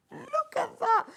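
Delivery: very high-pitched excited coo; delighted squeal. Real, not acted. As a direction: squeal with delight over a lovely find. Thrilled by a new fabric.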